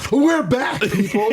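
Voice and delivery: high, excited on-air burst; fake-cheerful announcer. Miserable announcer back on air, forced upbeat broadcaster voice. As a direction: put on sudden showy cheer. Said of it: "act like everything's great".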